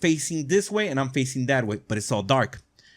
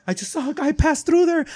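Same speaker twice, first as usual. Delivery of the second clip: mock startled girl; 13 semitones higher than usual. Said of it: "screams again".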